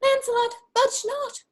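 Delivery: very high-pitched voice of conscience; high, insistent little conscience; conscience's voice. Acted. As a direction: forbid it in a high put-on voice. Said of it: "my conscience says".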